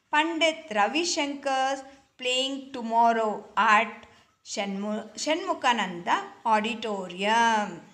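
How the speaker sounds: ordinary talk, nothing marked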